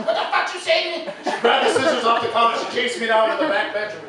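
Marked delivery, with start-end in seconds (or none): 0.0-1.0 mom's furious comeback. Retold or imitated. Mom voiced, furious reply.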